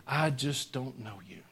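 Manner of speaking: soft, slow and gentle, no judgment in it; said with love. Shown as a demonstration. Jesus gently telling the self-deceived churchgoers he doesn't know them, with love. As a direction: let him down tenderly, like Jesus would. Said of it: "He's gonna say it with love".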